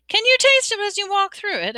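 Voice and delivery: put-on high, eager boyish asking; curious question. A narrator's reading. Harry asking a ghost about the food, curious.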